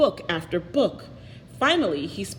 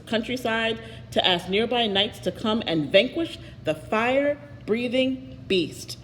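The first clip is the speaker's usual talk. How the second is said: ordinary delivery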